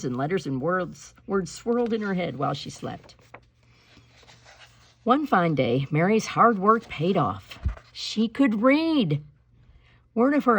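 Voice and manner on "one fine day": usual speaking voice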